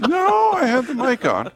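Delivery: high, loud protest through laughter; helpless high-pitched laughing voice. Real, not acted. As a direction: laugh while objecting. Laughing at being caught out.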